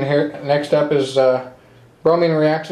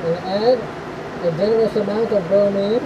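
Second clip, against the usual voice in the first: muffled, speaking through a respirator mask; 3 semitones higher, 4 dB quieter than usual. Real, not acted. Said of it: "I'm all geared up in the respirator".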